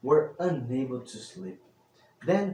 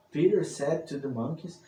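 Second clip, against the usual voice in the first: ordinary delivery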